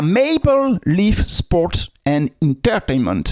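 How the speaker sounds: slow, showy English accent; practised English accent, syllables stretched; put-on English accent. Shown as a demonstration. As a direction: drawl the name in careful English. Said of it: "je suis obligé de travailler mon, mon accent".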